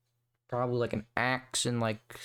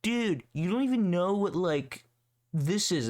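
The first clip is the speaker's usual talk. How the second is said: lightly voiced smug know-it-all, higher; mocking know-it-all; 5 semitones higher than usual. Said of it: "they're like".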